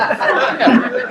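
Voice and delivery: very high airy laugh rising; a shrill burst of glee; high squealing laugh. A narrator's reading. Larry laughing at his manager in the net, high squeal of glee.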